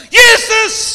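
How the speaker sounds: very high, loud cry; full-force acted emergency cry; desperate shout. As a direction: scream the name as if facing death. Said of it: "det eneste jeg roper når jeg ser døden".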